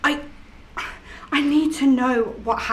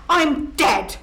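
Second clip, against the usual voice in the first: ordinary talk, nothing marked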